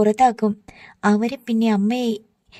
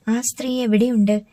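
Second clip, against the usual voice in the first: plain reading voice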